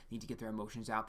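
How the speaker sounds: ordinary talk, nothing marked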